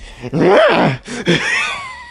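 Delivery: a squeaky voice-cracking cackle; laughing so hard the voice breaks high. Real, not acted. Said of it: "What was that voice crack?".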